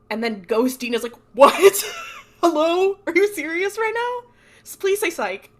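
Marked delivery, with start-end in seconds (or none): 1.3-5.5 high, loud, hammed-up incredulity; outraged disbelief. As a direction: squawk it in mock outrage, breaking into laughter. Ghost Dean's outraged disbelief, retold.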